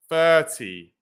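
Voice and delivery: "thirty", first syllable punched; stress on THIR. Shown as a demonstration. Teacher contrasting "thirty" with thirteen, stressing the first syllable. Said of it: "it's in the first syllable".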